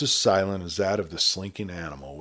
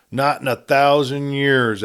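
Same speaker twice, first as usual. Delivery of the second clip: slow, higher, emphatic refusal; firm echo; 7 semitones higher, 7 dB louder than usual. A narrator's reading. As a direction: draw each word out in defiance. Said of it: "echoed Terry Jordan".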